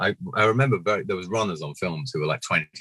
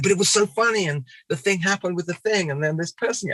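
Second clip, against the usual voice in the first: high-pitched excited babble; mock fan gushing; imitated insistent fan; 13 semitones higher than usual. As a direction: press the memory eagerly up high. Imitates fan pressing the memory.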